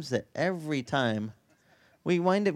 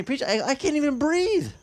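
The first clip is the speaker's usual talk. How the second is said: mock gasping, high and breathless; panicked friend; 13 semitones higher than usual. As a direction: play a friend who can't get air, half-joking. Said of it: "they're telling me".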